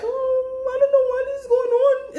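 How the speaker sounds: high crying whine, hammed-up; mocking falsetto sob; mock whining victim. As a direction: whimper like a fake innocent. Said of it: "they be like".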